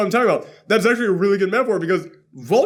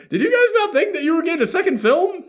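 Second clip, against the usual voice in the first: much higher, laughing disbelief; incredulous; 12 semitones higher, faster than usual. Retold or imitated. Critic asking the filmmakers, laughing incredulous question.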